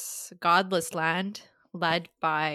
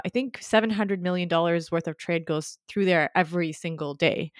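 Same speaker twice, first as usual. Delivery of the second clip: ordinary talk, nothing marked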